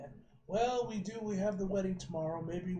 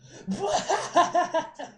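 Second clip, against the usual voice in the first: high, loud burst of laughter; loud cackle; 10 semitones higher, 9 dB louder than usual. Acted. Onlooker laughing at the dancing man, loud cackle. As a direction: cackle hard in a rapid burst.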